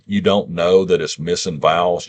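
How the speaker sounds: ordinary talk, nothing marked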